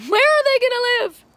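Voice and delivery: giggling high-pitched echo of the question; high laughing repeat. Acted.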